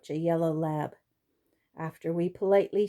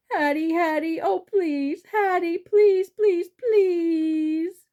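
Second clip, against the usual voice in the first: higher and louder, rising to a near cry; whining plea; 9 semitones higher, 5 dB louder, slower than usual. A narrator's reading. Hungry dog pleading with Hattie, rising whining cry. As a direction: beg more and more desperately, almost crying. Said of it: "I whine some more".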